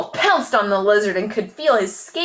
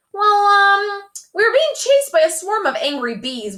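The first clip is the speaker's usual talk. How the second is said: flustered; 13 semitones higher, 5 dB louder than usual. A narrator's reading. Freddie flustered before dad.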